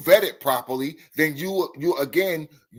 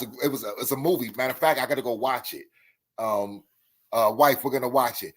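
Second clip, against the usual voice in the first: ordinary talk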